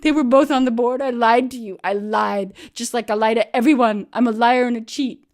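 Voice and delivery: loud, high tearful confession; crying friend owning up, high and loud. Retold or imitated. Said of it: "Bean cried".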